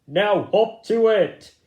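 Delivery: loud, high barked command; bossy order. Acted. Bigwig ordering the tired rabbits on, loud. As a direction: bark it at the whole group.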